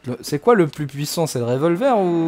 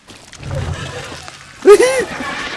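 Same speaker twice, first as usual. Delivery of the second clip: shrill high scream; high panicked shriek; 5 dB louder than usual. Real, not acted. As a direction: scream in terror. Shriek at jump scare.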